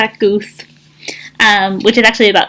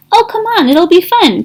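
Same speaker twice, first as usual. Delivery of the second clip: coaxing excited; 9 semitones higher than usual. Acted. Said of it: "quacked Duck".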